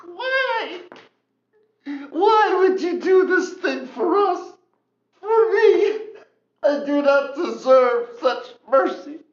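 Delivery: high, slow, tearful voice; crying out, overwhelmed by mercy; weeping. Acted. Man weeping before Peter, high tearful voice.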